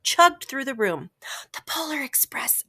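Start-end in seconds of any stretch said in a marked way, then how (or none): 1.5-2.6 breathy hushed whisper. A narrator's reading. Child whispering to the lion in awe. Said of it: "I whispered to the lion".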